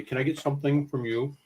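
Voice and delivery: ordinary delivery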